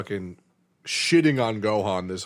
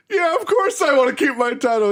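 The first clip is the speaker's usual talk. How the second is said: higher, louder eager agreement; high eager Hercule; 12 semitones higher, 5 dB louder than usual. Retold or imitated. Hercule eagerly agreeing to keep his title, high and loud. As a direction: pipe up eagerly. Hercule voiced in retold fight.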